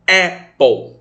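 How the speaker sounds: Apple said with open E, like "épol"; correct pronunciation. Shown as a demonstration. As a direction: model Apple clearly, open first vowel. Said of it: "o tio Gabriel falou que é apple". (Apple com e aberto, "épol")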